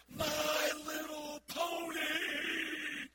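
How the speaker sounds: slow, stretched high shout; drawn-out ecstatic kid's shriek; excited scream. Retold or imitated. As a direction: scream it like a thrilled little girl. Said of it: "they screamed at the top of their lungs".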